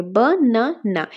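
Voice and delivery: Banana very slowly in three parts, বা, না, না; বানানা as three drawn-out, separate beats; syllable by syllable. Shown as a demonstration. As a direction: pause after each syllable of banana. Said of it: "তিনটা ভাগে".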